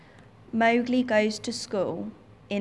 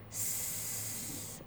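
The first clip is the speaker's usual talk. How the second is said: a long soft hiss like a snake; 15 dB quieter than usual. Acted. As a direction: hiss like Kaa. Kaa the snake arrives.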